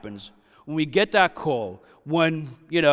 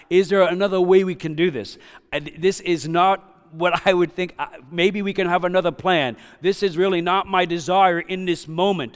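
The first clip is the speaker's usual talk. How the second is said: pleading to get out; 5 semitones higher, 5 dB louder than usual. Acted. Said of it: "Jesus kinda said he wanted out".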